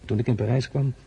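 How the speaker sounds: normal talking voice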